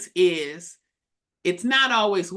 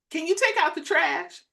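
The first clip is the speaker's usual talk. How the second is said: pitched up, full-voiced request; her usual tone demonstrated; 7 semitones higher than usual. Shown as a demonstration. Wife showing how her request really comes out, full-voiced. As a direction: ask it higher, without softening a bit. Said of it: "I may say".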